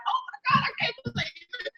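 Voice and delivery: tiny, very high, soft weepy voice; hushed, fast mock crying; imitated tearful mother. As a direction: squeak it out as if overcome with tears. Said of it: "they crying and they're like".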